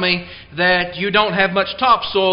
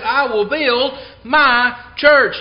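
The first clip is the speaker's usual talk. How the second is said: slow, higher, MY held apart between pauses; emphatic stress on MY; 5 semitones higher, 4 dB louder, slower than usual. Real, not acted. Preacher showing the church belongs to Christ, emphatic on MY. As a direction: land hard on MY before church. Repeats quote stressing possessive MY.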